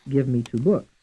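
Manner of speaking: "books" carries the final beat; stress on BOOKS. Shown as a demonstration. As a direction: save the emphasis for "books" at the end. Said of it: "stressed on the fourth syllable".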